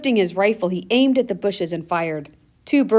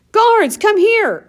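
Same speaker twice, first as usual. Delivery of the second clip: loud, high, slow call across distance; shouting; 7 dB louder, much slower than usual. A narrator's reading. Uncle Avram calling the guards from the woods, loud slow shout. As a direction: call them away from the train. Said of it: "Uncle Avram shouted from the woods".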